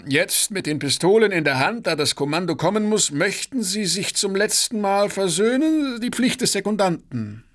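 high, formal calling-out voice; proclaiming aloud. A narrator's reading. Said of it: "was er selbst laut verkündete". (laut verkündend)

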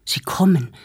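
panting, urgent first warning; breathless alarm. Acted. Arnost bursts into the room, out of breath, warning. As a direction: gasp it out, winded from running. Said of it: "schwer atmend".

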